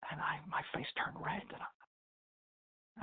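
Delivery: hushed confession, face burning; ashamed whisper. Retold or imitated. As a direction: breathe it out in shame. Old man ashamed before the Rebbe.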